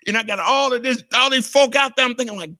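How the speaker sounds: ordinary talk, nothing marked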